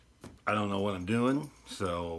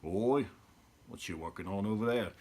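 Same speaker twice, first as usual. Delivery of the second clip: rough cockney-style British accent, admittedly bad; mock London geezer calling out, lightly done; a bad, half-hearted cockney impression; low-class British accent. Shown as a demonstration. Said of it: "the low-class British one".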